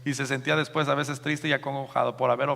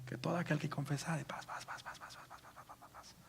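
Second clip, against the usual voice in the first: whispered patter fading to nothing; recited verse trailing off; 17 dB quieter than usual. Acted. Believer quotes the Bible by heart, whispered patter. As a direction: rattle it off under your breath, fading away.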